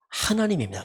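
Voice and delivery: ordinary delivery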